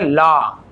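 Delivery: ला with a long open aw vowel; correct pronunciation. Shown as a demonstration. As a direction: say ला as one drawn aw sound. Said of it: "लेकिन वो गलत है". (सही उच्चारण, ला लंबे ऑ के साथ)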